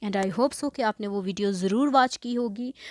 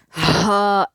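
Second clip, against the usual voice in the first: loud breathy "हा" sent out from the belly; breath pushed out; 9 dB louder than usual. Shown as a demonstration. Teacher shows how to push breath out, loud. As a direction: blow the air out hard on हा. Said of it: "ऐसे करेंगे".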